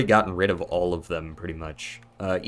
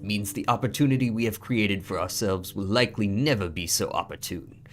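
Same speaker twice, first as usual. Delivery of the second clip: grand solemn pharaoh reading a letter; booming pharaoh. Acted. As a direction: proclaim it like an ancient ruler. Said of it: "That's absolutely a Pharaoh voice".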